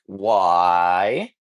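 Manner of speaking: low, lightly voiced wary clerk asking why; a hint of a gruff clerk; suspicious shopkeeper. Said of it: "they'll be like".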